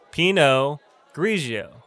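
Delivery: Pinot Grigio slowly, PEE-no... GREE-zhee-oh; slow pronunciation. Shown as a demonstration. Teacher models a wine name, slow pronunciation. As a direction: split Pinot Grigio, silent t in Pinot. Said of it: "It's pronounced".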